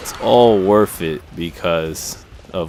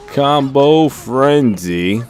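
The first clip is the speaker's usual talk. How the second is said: excited; 7 semitones higher, 4 dB louder, much slower than usual. Real, not acted. Calling out game move.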